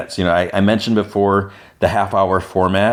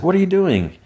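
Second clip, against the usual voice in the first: mock yell; 12 semitones higher than usual. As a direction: yelp it as a retold telling-off, without full volume. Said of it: "Pin Lee yells".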